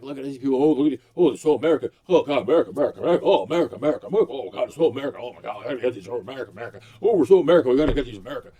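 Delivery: sarcastic impression of flag-waving shoppers; mocking patriots. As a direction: fawn over America like a dim superfan. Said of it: "I'm like".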